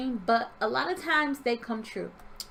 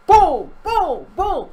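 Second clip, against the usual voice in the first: very loud, punched-out booms; explosive mimicked thuds; imitated kicking bangs; 7 semitones higher, 10 dB louder, much slower than usual. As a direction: slam each one like a kick on a door. Imitates door being kicked.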